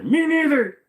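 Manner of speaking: high, loud mock commenter voice; random commenter. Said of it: "just your random commenter".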